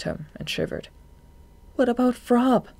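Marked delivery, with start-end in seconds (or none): 1.7-2.7 high, urgent asking; anxious question. A narrator's reading. Marina asks Shade about their pursuer, anxious. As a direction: press the question, pitched well up.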